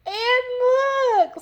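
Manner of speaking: very high, loud squeal; Little Honey. A narrator's reading. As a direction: squeal it like a tiny bear cub. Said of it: "squealed Little Honey".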